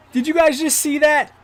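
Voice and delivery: high, loud amazed shout; excited shout. Real, not acted. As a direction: yell it in disbelief. Great defensive play happens.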